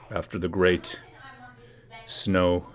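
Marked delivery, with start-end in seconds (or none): none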